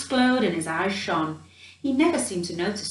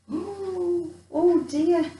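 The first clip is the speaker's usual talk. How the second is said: startled gasp as the guards spring; cried out; 9 semitones higher, much slower than usual. Acted. Gerda attacked by palace guards, frightened cry. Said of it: "As Gerda cried out".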